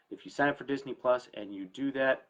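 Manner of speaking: usual speaking voice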